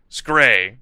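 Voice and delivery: "Scray" said as one syllable rhyming with "gray"; misspelling read literally. Shown as a demonstration. Reader correcting scary to the misspelling, pronounced "Scray". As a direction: say "Scray" with emphasis, like "skray".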